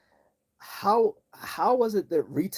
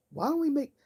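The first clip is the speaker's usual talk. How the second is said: mock idea voice. Imagined retailer idea, high.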